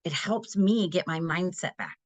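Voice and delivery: ordinary talk, nothing marked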